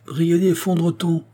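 high-pitched, acted call. A narrator's reading. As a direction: hail him from a little way off. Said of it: "cria-t-il".

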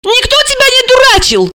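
high-pitched cross retort; angry. A narrator's reading. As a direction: snap back, voice shooting up. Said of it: "рассердилась Алиса".

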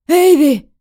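loud anguished call; desperate shout. Acted. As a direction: call her name back. Marshal calls back.

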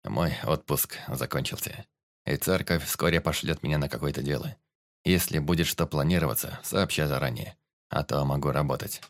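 low, subdued priest voice; sour priest Ром, resigned. Acted.

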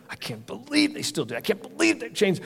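high, hushed mock indignation; mock complaining. Churchgoer grumbling about others on the way to church, mock complaining. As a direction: put on a high, small grumbling voice.

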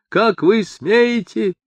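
high, drawn-out outraged squeal; slow, high-pitched indignation; indignant squeal. A narrator's reading. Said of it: "взвизгнул Эр Квадриго".

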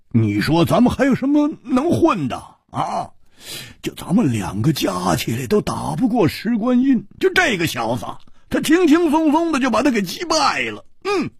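high, worked-up incredulous complaint; exasperated grumbling. Acted. Grumbling that his friend won so easily, exasperated and incredulous. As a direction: build to a high-pitched protest of wounded pride.

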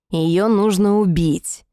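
slow, grim demon voice; menacing, drawn out. Acted. Scaled demon demands killing.

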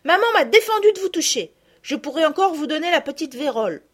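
lightly voiced anxious girl; excited girl. A narrator's reading. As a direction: warn them off eagerly.

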